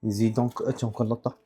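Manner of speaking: ordinary talk, nothing marked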